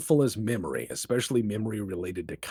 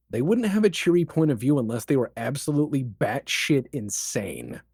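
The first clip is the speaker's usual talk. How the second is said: normal talking voice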